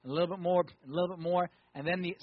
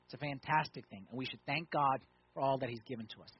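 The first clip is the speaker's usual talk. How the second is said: ordinary delivery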